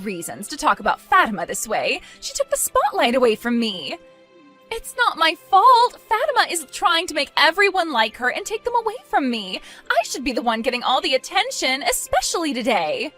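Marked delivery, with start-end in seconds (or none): 4.7-9.6 petulant protest. Acted. Daughter blames Fatima to her mother, petulant protest. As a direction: protest the blame, pitched high. Bride defends herself to mother.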